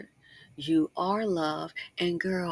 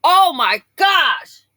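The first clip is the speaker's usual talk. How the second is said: slow, much louder and higher exclamation; exclaiming; 10 semitones higher, 12 dB louder, much slower than usual. Retold or imitated. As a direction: stretch out a shocked outcry. Imagining husband's reaction.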